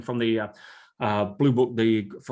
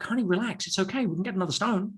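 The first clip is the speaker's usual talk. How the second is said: soothing fiancée; 6 semitones higher than usual. Retold or imitated. Calming fiancée in retold story.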